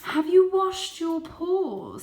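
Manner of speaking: very high, loud, slow motherly voice; a fussing mother checking on her kitten; fussy mother. A narrator's reading. Mother cat asks her son about his paws, fussy and high. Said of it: "said Mother".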